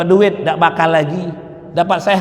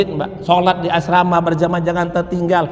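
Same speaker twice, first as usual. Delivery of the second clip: ordinary talk, nothing marked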